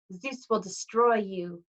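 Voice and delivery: slow, drawn-out threat; Missus Dodds threatening. A narrator's reading. Fallen Fury to the boy, slow menace. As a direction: promise ruin, word by word.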